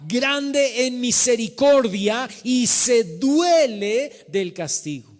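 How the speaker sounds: loud, drawn-out sermon cadence; full-force preaching. Acted. Pastor proclaiming God's mercy to the congregation, emphatic preaching. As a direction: preach it, stretching the key words.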